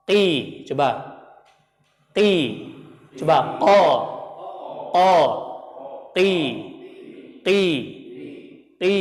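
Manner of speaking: qi and qa said slowly, each syllable set apart for students to copy; teacher's qaf demo. Shown as a demonstration. Teacher models qaf syllables for students to repeat. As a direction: model qi with a thick, back-of-throat qaf. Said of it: "Contoh seperti huruf qaf, ketika dia kasrah".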